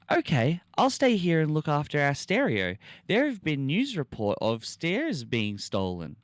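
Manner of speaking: a hint of a sweet girlfriend; lightly voiced girlfriend.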